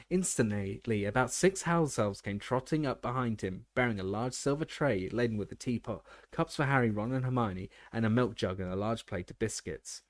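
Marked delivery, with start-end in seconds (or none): none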